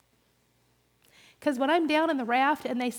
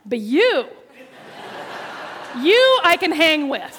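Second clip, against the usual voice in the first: loud, higher laughing delivery; laughing punchline; 5 semitones higher, 11 dB louder, slower than usual. Retold or imitated. New lady telling teller she can keep up, laughing loudly. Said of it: "and she said".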